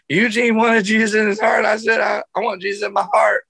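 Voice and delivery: put-on high, pleading child's voice, hurried at the end; a sobbing seven-year-old explaining himself; crying kid. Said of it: "I go".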